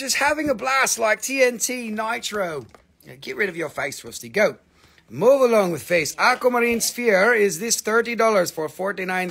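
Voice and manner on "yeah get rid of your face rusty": ordinary talk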